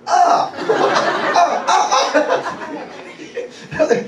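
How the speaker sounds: high "ah" echoed back, amused; high repeated cries, breaking into laughter; responding exclamation. Retold or imitated. Preacher reenacts answering her, high playful exclamations. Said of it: "and I responded".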